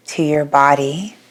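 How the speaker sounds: unhurried yoga nidra guidance with long pauses; guided meditation. Real, not acted. Yoga teacher guiding listeners to settle their bodies, calm guided meditation. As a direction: ease them into rest, phrase by phrase, leaving long silences.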